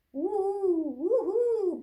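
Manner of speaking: high, loud whoop; storybook owl's hooting cheer; gleeful cheer. A narrator's reading. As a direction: whoop with joy, long drawn-out vowels. Young owl cheers at bedtime.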